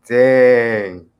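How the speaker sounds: ordinary talk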